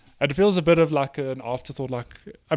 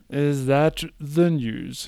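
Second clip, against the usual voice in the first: slow mock question; much slower than usual. Real, not acted.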